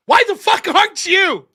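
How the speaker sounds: angry retort. Real, not acted. Vlogger firing back at critics who call him angry. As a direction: snap back at them in outrage.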